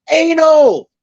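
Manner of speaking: a sudden high yell from the crowd. Retold or imitated. Girlfriend yelling an answer to the comedian in the crowd, high yell. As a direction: blurt it out high and loud. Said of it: "she yells out".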